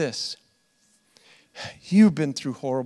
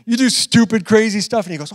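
usual speaking voice

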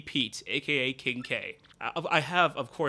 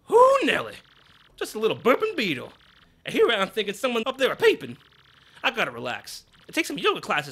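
much higher, louder folksy relief; hammy boss laughing off a scare; Grubba's voice; 7 semitones higher, 7 dB louder, faster than usual. Acted. Boss relieved it was only a beetle.

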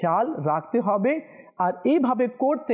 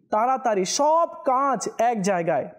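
ordinary talk, nothing marked

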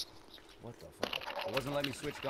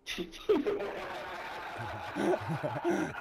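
high, drawn-out giggling laugh; long laugh; 7 semitones higher than usual. Real, not acted. Gamer amused by his American act, long high laugh. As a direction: crack up at your own joke. Cracks up at own bit.

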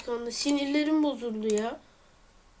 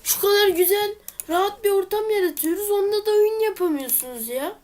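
annoyed rant; 5 semitones higher than usual. Real, not acted. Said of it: "Sinirlendim ya".

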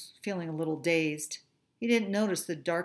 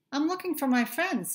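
small fish voice; 8 semitones higher than usual. Acted. Little fish voice.

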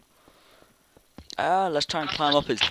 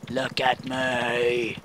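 silly slow voice; 4 semitones lower, much slower than usual. Pretend.

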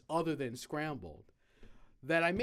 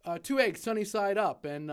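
loud, higher call of an egg order; shouting an order; 6 semitones higher, 6 dB louder than usual. Retold or imitated. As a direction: bark the order over the kitchen noise. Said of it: "he shouted out".

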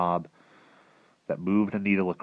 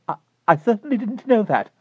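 much higher, loud, startled; nervous lady; 7 dB louder than usual. Acted. Mrs Saunders answering the strange man, nervous. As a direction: answer in a flustered hurry. Frightened neighbor replies.

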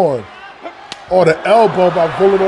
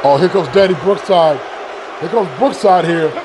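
normal talking voice

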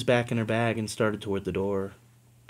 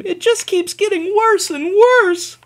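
tearful; 8 dB louder than usual. Acted. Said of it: "She began to sob".

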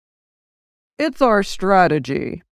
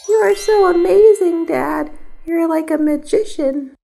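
put-on overjoyed child praising dad the magician; amazed child; 10 semitones higher than usual. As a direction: gasp with wonder at the trick.

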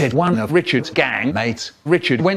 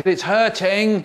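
mild pained yell; 6 semitones higher, slower than usual. A narrator's reading. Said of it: "he yelled".

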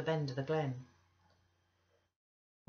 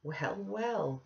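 a touch of irritation, pitched up; mildly cross; 4 semitones higher than usual. A narrator's reading. Husband to his embracing wife, mildly cross. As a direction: brush her off, slightly peeved. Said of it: "cried her husband crossly".

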